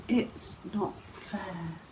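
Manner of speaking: very soft, slow, subdued protest; not so angry. Shown as a demonstration. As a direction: let the anger go, say it quietly and slowly. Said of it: "say it not so angrily".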